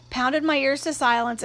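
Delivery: ordinary delivery, nothing marked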